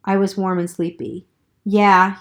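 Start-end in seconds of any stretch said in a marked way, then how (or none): none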